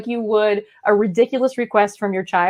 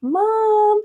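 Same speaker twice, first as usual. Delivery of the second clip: put-on much higher, whiny kid voice; pleading child; 8 semitones higher, slower than usual. Child begging mom for a pony, whiny. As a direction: beg like a kid who wants a pony. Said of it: "when they're like".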